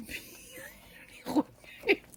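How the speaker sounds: breathless whispered giggle; laughing whisper. Real, not acted. Laughing at her own mix-up, whispered giggle.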